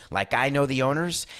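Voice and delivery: ordinary talk, nothing marked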